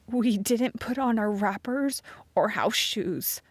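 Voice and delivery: slow, breathy shivering whisper; teeth chattering. A narrator's reading. Sarah Jane cold on the stairs, teeth chattering. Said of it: "Sarah Jane's teeth chattered".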